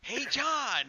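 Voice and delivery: high, sing-song doll call; creepy doll voice. Retold or imitated. Doll calling the voodoo master, high and sing-song. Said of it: "his voice, it's, like, just perfect".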